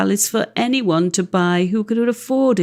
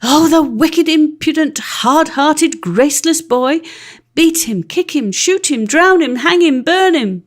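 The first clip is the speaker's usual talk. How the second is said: put-on higher, louder furious cries at the boy; angry mob shouting; 7 semitones higher, 4 dB louder than usual. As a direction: howl it like an outraged crowd.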